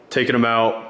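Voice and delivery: usual speaking voice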